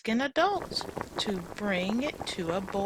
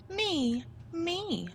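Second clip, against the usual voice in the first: eager little Pip; 9 semitones higher than usual. Acted. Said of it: "said Pip".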